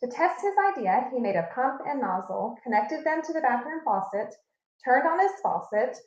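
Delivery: ordinary delivery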